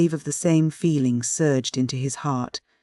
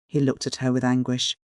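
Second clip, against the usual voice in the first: ordinary delivery, nothing marked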